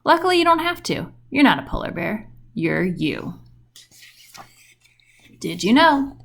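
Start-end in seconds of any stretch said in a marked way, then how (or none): none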